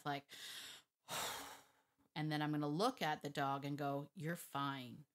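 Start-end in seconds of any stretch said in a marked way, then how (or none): 1.1-1.5 big slow sigh; deep breath out. Shown as a demonstration. Trainer resetting before addressing her dog, deep exhale. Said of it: "take a big old deep breath". 4.2-5.0 low, quiet, matter-of-fact dismissal; firm calm tone. Shown as a demonstration. Trainer telling her dog to knock it off, calm and firm. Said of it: "In that tone".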